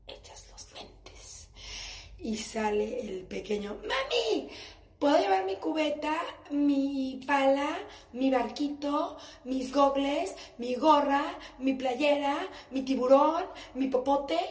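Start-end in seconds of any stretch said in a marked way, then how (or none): 0.1-1.5 slow resigned whisper. Real, not acted. 3.8-14.4 put-on high, loud excited kid; little boy voice. Little son voiced listing toys.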